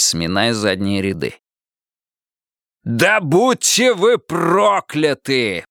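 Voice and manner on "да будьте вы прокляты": bellowing curse. A narrator's reading. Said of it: "проорал мэр Пересолин".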